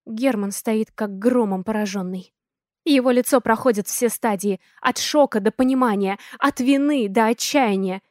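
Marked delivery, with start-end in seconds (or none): none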